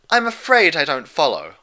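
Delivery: a lightly voiced elegant lady, lost; Rarity admitting confusion. A narrator's reading. Rarity admitting to friends she doesn't follow. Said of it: "Rarity admitted".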